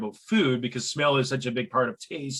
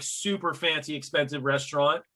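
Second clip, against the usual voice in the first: ordinary talk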